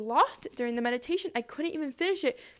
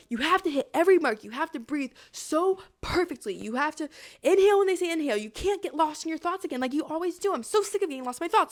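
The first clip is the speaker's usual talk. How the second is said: mildly frantic self-lecture, higher pitched; self-scolding; 4 semitones higher, 5 dB louder than usual. Retold or imitated. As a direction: berate yourself under mounting pressure. Scolding herself during meditation.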